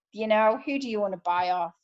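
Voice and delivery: usual speaking voice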